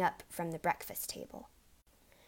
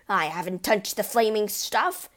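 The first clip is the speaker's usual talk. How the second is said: loud indignant outburst; angry shouted protest; 7 dB louder than usual. A narrator's reading. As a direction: bellow the denial at his wife. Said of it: "Mister Wormwood shouted".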